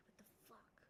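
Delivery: whispered swear; whispering. Real, not acted. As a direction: hiss it under your breath. Itchy ear, reacts to self.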